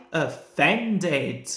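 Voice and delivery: plain reading voice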